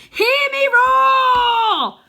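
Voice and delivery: long, drawn-out high battle roar; Arthur's big battle cry, drawn out and shouted; full shout. A narrator's reading. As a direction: hold the roar as long as you can. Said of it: "I yell".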